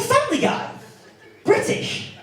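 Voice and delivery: very high, disbelieving questions; incredulous. Acted. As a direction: squeak each question in mock disbelief. Incredulous reply to her comparison.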